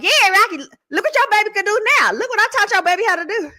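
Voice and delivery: high, crowing imitation of an auntie; bragging auntie. Auntie boasting to daddy about what she taught, bragging. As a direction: crow proudly, pitched well up.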